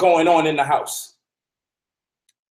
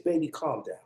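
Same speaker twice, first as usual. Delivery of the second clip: much softer, gentle calming; 2 semitones lower, 11 dB quieter than usual. Retold or imitated. Woman calming a yelling man, softly soothing. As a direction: settle him with a hushed voice. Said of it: "But if you say".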